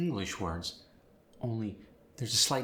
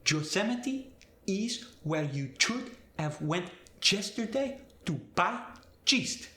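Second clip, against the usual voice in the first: Spanish accent, Yosemite, yesterday, yeast with a J, much higher; 9 semitones higher than usual. Shown as a demonstration. Teacher demonstrating Y to J, Spanish accent. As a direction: turn every Y to a J, Spanish style. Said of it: "Y sound gets changed to the J sound".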